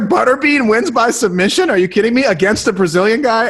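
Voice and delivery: Butterbean winning by submission can't be real; disbelief. Retold or imitated. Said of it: "I was like".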